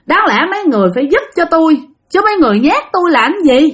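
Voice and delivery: high-pitched, quick indignant complaint; acted exasperated telling-off; scolding the ghosts. Bà Bảy mắng đám ma nhát mình.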